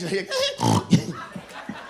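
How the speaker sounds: loud hog-like snort in a laugh; imitated laughing snort. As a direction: mimic a woman snorting as she laughs. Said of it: "she snorts like that".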